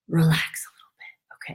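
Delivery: a soft unvoiced whisper. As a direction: breathe it out as a secret. Soothing aside.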